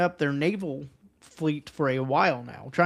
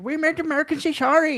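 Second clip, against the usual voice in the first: high, loud, boastful mock official voice; mock boastful Chinese official; 10 semitones higher, 6 dB louder than usual. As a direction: crow it like a gloating state official. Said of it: "be like".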